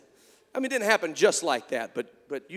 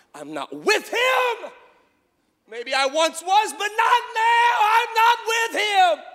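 high, loud and slow, tearing himself away; anguished shouted denial; 14 semitones higher, 9 dB louder, slower than usual. Retold or imitated. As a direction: shout it like cutting all ties. Peter renounces Jesus.

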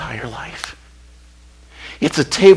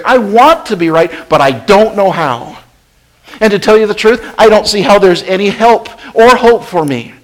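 raised, urgent plea to God; pleading prayer; 6 semitones higher, 8 dB louder than usual. Acted. Believer confessing to God that he might be wrong, raised and urgent. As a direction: beg God for help, pressing hard. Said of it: "come back around and say".

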